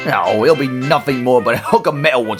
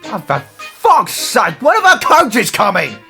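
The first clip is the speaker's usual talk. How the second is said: impatient outburst; 6 semitones higher than usual. Acted. Silas impatient for the coaches.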